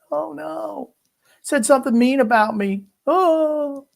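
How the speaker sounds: high, whiny crybaby voice; mock the offended player, high and whiny; whiny mock athlete. Mocking soft athletes being offended.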